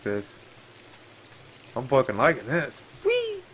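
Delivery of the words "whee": high squealed whee; gleeful whee. Real, not acted. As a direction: squeal with glee like on a slide. Playful cheer while jumping.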